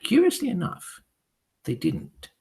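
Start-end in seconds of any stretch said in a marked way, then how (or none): none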